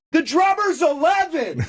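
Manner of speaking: very high, drawn-out holler; desperate punchline. Retold or imitated. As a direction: oversell a joke to a dead room. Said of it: "one punchline was like".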